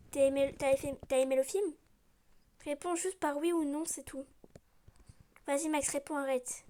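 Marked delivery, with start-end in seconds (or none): none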